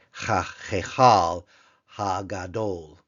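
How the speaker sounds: slow "ha-hechal ha-gadol." with loud first word; Hebrew phrase. Shown as a demonstration. Teacher reads the great temple phrase to class, slowly. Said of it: "the great temple".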